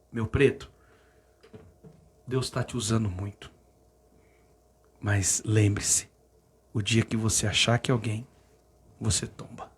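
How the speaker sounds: low, soft retold mentor's warning; conselho paternal. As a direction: counsel gently and gravely, with long pauses. Bispo aconselha o jovem com ternura. (conselho manso, em voz baixa e grave)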